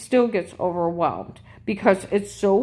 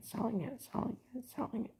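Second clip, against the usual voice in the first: trailing off to a murmur; muttered under breath; 6 semitones higher, 16 dB quieter, faster than usual. Real, not acted. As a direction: mutter it to yourself, fading. Repeating it under her breath.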